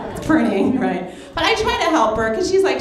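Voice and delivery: usual speaking voice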